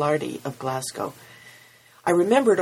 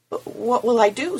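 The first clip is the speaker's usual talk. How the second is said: close to tears; 8 semitones higher, 5 dB louder than usual. Acted. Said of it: "big tears welling up".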